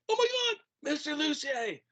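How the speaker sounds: high, thrilled cry of recognition; excited former student. Retold or imitated. Former student greeting his old teacher, high thrilled cry. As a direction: squeal it like spotting a favourite teacher. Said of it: "He's like".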